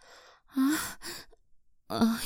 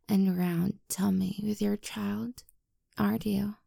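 ordinary talk, nothing marked

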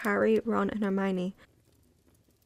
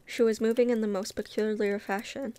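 plain reading voice

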